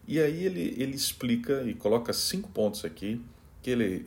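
ordinary talk

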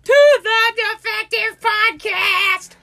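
high, loud, stretched-out podcast title; over-the-top intro yell, very slow and high; drawn-out intro shout. Pretend. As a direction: belt out the show name, holding every word.

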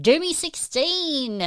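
high, drawn-out name call; excited shout-out. Real, not acted. Host greets a viewer joining the stream, excited shout-out.

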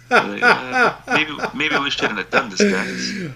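high, guilty mock soldier voice; sheepish soldier. Soldier telling his fellow executioners in a high sheepish voice.

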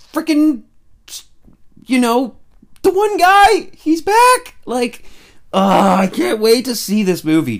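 high, loud fan squeal over the trailer; gushing giddy and high; excited squeal. Real, not acted.

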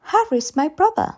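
put-on high child's voice, simply stating it; small girl, matter-of-fact, lifting on "brother"; high, childlike reply rising at the end; little girl's statement. A narrator's reading. Child first states who Harry is.

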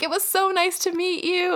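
high, sweet fangirl quote; imitated teenage fans. Girls commenting on her live video, imitated fangirl. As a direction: pitch it up like a gushing fan.

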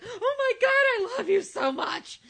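gushing fan. Retold or imitated. Mocking how she would gush at a celebrity, high and slow. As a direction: ham up a starstruck fan. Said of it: "I don't wanna be like".

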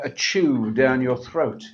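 ordinary delivery

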